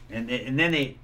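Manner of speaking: normal talking voice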